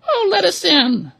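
very high, loud squawky hen voice; pleading hens. A narrator's reading. Hens begging the ducks to let them in, squeaky. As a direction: beg to be let in like frantic hens. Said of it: "these poor birds cried".

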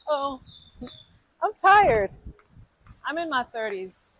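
usual speaking voice

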